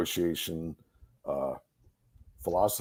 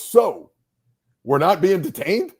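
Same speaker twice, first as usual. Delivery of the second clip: smartass question; 9 semitones higher, 7 dB louder than usual. Retold or imitated. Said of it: "and goes".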